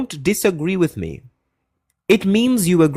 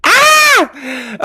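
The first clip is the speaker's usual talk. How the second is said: startled shriek; 13 semitones higher, 6 dB louder than usual. Real, not acted. Reacting to question.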